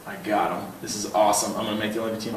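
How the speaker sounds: a hushed thrill of thinking he's won; excited inner voice. Retold or imitated. Swimmer tells audience his race thoughts in a hushed inner voice.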